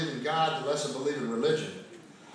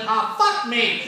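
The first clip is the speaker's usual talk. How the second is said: put-on kid's slow curse; 8 semitones higher, 5 dB louder, much slower than usual. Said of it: "I hear click".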